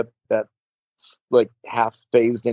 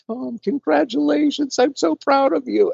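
high, bright congratulations, much higher; high Paul Rubens voice. Retold or imitated. Paul Rubens congratulates Tom backstage, high squeaky voice. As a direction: squeal out the congratulations like Pee-wee. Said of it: "He's like".

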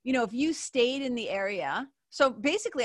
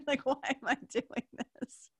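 higher, softer, broken up by real laughter; laughing; 4 semitones higher, 3 dB quieter than usual. Real, not acted. Podcaster mocking her own annoyance on the drive, laughing. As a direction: laugh through the complaint.

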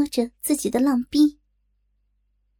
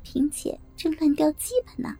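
softer, giggling under breath; quiet laughing; 2 semitones higher, 6 dB quieter than usual. Acted. Narrator whispering gossip to Hai-ge, soft giggling. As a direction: tell a secret through giggles. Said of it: "笑着小声说".

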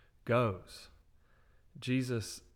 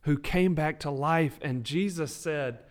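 louder, rising preacher's cry; preaching at full force; 3 semitones higher, 6 dB louder than usual. Real, not acted. Podcast host preaching his own rescue, fervent build. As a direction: preach it, building with each line. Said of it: "start letting you preach".

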